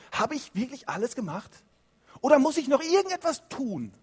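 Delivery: anxious doubter. Shown as a demonstration. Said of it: "Betonung auf ich".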